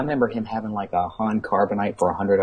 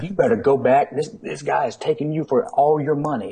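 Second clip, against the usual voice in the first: imitating angry dad; 6 semitones higher than usual. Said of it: "And he was livid".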